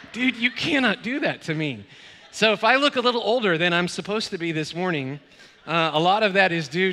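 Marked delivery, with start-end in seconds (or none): 0.1-1.9 laughing, higher protest. Retold or imitated. Colleague laughing off the pastor's cruel prank call. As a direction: scold your friend while still laughing. Relieved after prank revealed.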